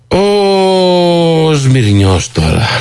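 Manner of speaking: high, very slow, stretched out; dramatic drawn-out intro. Acted. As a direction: drag out the name for suspense. Dramatic pause naming the man.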